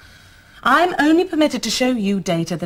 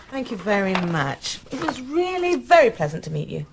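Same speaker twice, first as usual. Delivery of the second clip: ordinary delivery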